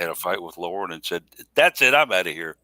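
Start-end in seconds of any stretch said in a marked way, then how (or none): 1.5-2.6 loud, hammed-up exit line; mock storming off. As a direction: play at quitting in a huff, louder. Joking imagined quarrel before storming off.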